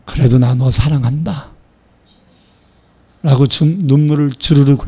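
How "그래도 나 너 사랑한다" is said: tender mother. Acted. Mother embracing her hurtful son, tender. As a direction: hold the child close with words.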